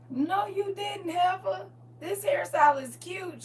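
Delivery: high-pitched, mock-offended comeback; indignant retort. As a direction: snap back as the insulted mom. Acts out mother's indignant retort.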